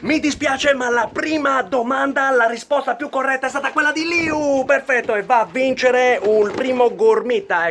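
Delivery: loud excited winner announcement; showy game show host. Acted. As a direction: announce the prize with flair. (annuncio del vincitore entusiasta)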